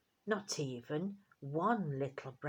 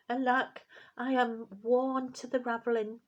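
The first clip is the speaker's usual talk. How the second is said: a lightly voiced tired old tailor; weary old tailor; 7 semitones higher, 6 dB louder than usual. A narrator's reading. Old tailor wakes and sees his twist, lightly weary. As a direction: sigh it out as a worn-out old man.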